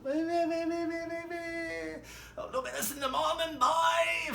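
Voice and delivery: very high repeated waits, loud; high pleading voice. Retold or imitated. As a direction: beg them to hold on. Boss pleading while being carried.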